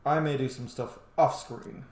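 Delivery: ordinary talk, nothing marked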